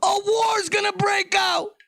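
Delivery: shouting a panicked warning from the feds; mock alarmed fed. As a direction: yell it like a spooked agent sounding the alarm. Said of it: "They might be right now like".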